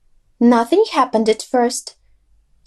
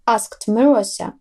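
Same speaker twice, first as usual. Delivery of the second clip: ordinary delivery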